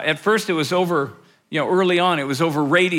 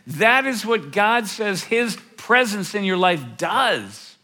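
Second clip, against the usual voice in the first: ordinary delivery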